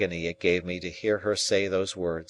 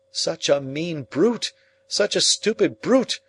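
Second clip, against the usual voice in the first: higher, louder, slow anguished plea; despairing outcry; 8 semitones higher, 4 dB louder than usual. A narrator's reading. Pip protesting Estella's choice of Drummle, despairing. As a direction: cry out in despair. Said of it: "I urged in despair".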